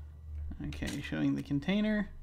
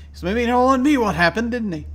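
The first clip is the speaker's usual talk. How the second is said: agitated rant; 8 semitones higher, 9 dB louder than usual. Acted. Paranoid diary rant.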